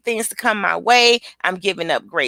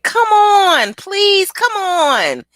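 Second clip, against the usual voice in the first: high, loud kiddie begging; imitated pleading child; 11 semitones higher, 6 dB louder, much slower than usual. Child begging Queen to come over, high and loud. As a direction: mimic a child begging insistently. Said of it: "She said".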